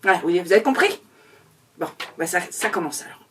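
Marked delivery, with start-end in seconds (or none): none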